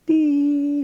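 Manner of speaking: high, held "dee" on one note; sine wave tone. Shown as a demonstration. Teacher contrasting sine with square wave, high steady tone. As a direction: sound out a smooth electronic beep, high and long. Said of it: "sine wave".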